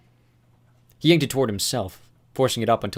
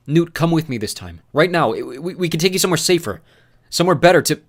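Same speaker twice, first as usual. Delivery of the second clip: higher, louder urgent plea; urgent pleading; 8 semitones higher, 9 dB louder than usual. Acted. Thomas urging his friend to flee with him, urgent and louder. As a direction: press him hard to come now. Thomas urges Newt to come.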